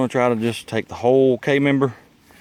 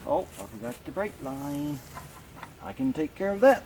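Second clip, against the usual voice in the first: ordinary delivery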